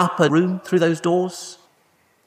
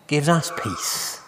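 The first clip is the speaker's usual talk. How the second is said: ordinary delivery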